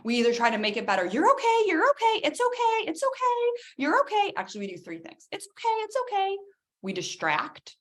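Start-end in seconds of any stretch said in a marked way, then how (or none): 1.1-4.3 put-on much higher, sing-song hushing of a crying kid; soothing parent. 5.3-6.4 soothing parent. Acted. Soothing a crying child.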